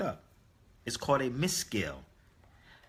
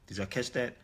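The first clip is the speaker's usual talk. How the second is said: ordinary talk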